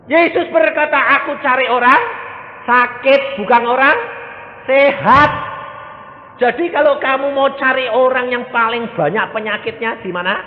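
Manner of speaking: loud, high-pitched sermon delivery; full-force preaching. Real, not acted. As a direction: hammer it out to the congregation. (khotbah lantang berapi-api)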